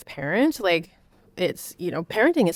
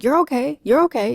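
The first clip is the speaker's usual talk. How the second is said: higher, louder brush-off, "You're okay" tossed off quickly; dismissive parent; 7 semitones higher, 4 dB louder than usual. Retold or imitated. Parent shutting down a crying child, higher and dismissive. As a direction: wave the child's tears away. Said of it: "Or the worst".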